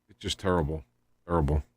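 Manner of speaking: normal talking voice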